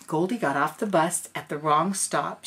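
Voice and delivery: plain reading voice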